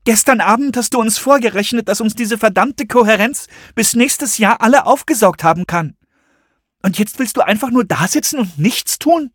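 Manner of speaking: hohe Frauenstimme, lauter, vorwurfsvoll; aufgebrachter Vorwurf. A narrator's reading. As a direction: wirf ihm hoch und laut vor, dass er nichts tut. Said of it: "brach es aus ihr heraus". (hoher, lauter, empörter Vorwurf)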